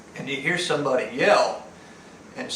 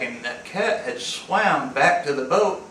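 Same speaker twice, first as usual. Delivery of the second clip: ordinary talk, nothing marked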